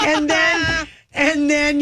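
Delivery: bubbling over with glee. Real, not acted. Laughing excited retelling.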